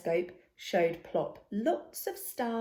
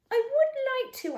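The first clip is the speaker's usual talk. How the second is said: much higher, loud hopeful start; hesitant owlet; 5 dB louder than usual. A narrator's reading. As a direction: reach up high and hopeful. Timid Plop answering the cat.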